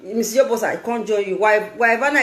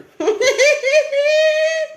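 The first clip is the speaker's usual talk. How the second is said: high shrieking burst of laughter; 14 semitones higher than usual. Real, not acted. Laughing hard at calling a viewer a fool. Laughs at her own insult.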